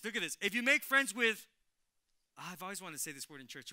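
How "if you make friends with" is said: building up. Real, not acted.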